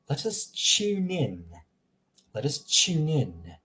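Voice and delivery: slow British accent, "tune" as "chune". Shown as a demonstration. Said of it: "in England, they would read it".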